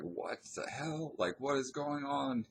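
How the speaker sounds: high-pitched, drawn-out disbelief; retold bewilderment. Telling how bizarre the friend's move felt, retold bewilderment. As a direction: stretch it out high, baffled, replaying the reaction.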